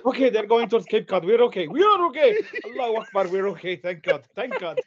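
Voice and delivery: loud, rising relief, peaking on are; loud relieved cheer. Pretend. Palestinians watching the boat head for Cape Cod, loud relief. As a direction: shout with relief they're safe.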